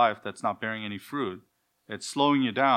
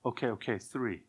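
put-on meek child; 7 dB quieter than usual. Child bargaining with father.

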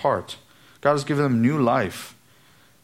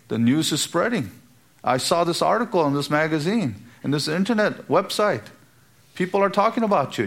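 higher, rushing, excited mock admirer; mock excited praise; 4 semitones higher than usual. Preacher mimics admirers praising online fame, higher and excited. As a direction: gush over someone's fame like a fan. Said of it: "That's how they would use the term".

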